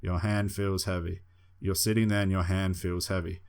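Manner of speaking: ordinary talk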